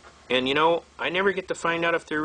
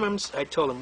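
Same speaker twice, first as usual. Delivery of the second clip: ordinary talk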